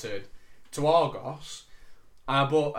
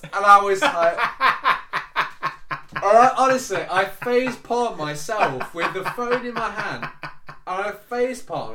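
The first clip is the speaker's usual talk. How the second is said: laughing; 6 semitones higher, 10 dB louder, slower than usual. Real, not acted. Laughing while retelling.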